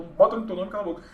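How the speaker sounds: normal talking voice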